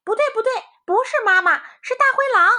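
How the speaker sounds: very high, loud and fast; alarmed cry. A narrator's reading. Rabbits see the wolf through the crack, high and loud.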